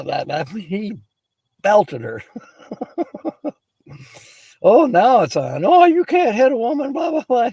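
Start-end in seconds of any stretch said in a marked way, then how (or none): 4.6-7.5 high, loud squeal of fake outrage; hammed-up indignant passenger; mock outraged woman. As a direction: shriek it like an indignant woman in a huff. Mocking the woman's indignant retort.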